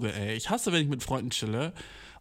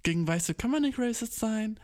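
cheeky correction; 9 semitones higher than usual. Retold or imitated. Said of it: "Und dann bin ich".

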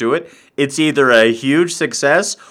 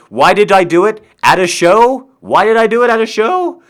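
mock incredulous; 7 semitones higher, 5 dB louder than usual. Mimics the questioners, irritated.

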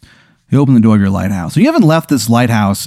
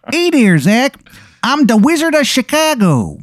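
high-pitched wizard with a badly faked Chicago accent; botched Chicago accent in a put-on high voice; terrible Chicago accent; 12 semitones higher than usual. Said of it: "a terrible accent. Almost like he doesn't know how to do the accent".

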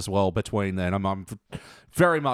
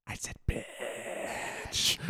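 very slow, hushed menace; drawn-out whisper; 12 dB quieter, much slower than usual. Acted. Podcaster mimics a character looking round, drawn-out whisper. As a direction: whisper it and stretch the last word right out.

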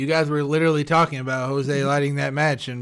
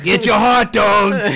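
street vendor call; 4 semitones higher, 8 dB louder, slower than usual. Pretend. Rat street vendor calling out.

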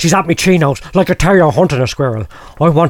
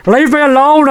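roaring; 6 semitones higher than usual. Retold or imitated. [name] roaring at the voices in his head. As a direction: roar it, pushing up in pitch. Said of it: "I roared".

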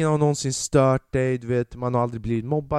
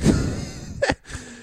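breathy laugh. Real, not acted. Comic laughs at own line.

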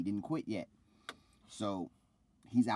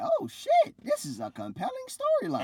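much higher and louder squeal of delight; mock amazed; 7 dB louder than usual. Recalling watching with his wife, hammed-up high excited squeal. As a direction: gush like a stunned fan, pitched way up. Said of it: "I was like".

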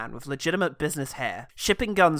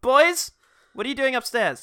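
calling upstairs; 8 semitones higher than usual. Pretend. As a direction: shout up to the boys.

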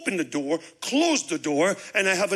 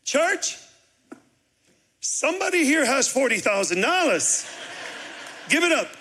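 rallying the church; 8 semitones higher than usual. Retold or imitated. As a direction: fire up the congregation. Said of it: "I go next Sunday to the church".

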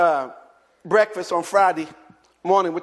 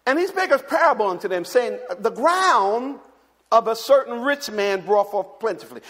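scripture reading; 4 semitones higher than usual. Acted. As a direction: read the verse with weight.

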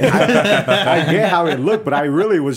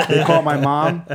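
normal talking voice

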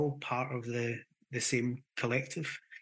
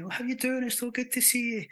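high-pitched, polite hello to a dog; gushing friendly greeting; 10 semitones higher than usual. Acted. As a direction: act out greeting a dog like an old friend, much higher. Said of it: "go up to a dog and say".

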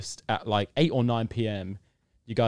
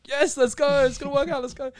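excited; 3 dB louder than usual. Retold or imitated. Celebrating the meeting news.

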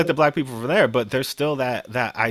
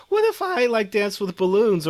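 mock dreamy daydream; 9 semitones higher than usual. Podcast host mocking a carefree fantasy, in a giddy high voice. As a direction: leap way up high into a giddy, fanciful question.

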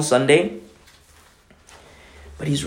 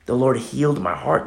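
usual speaking voice

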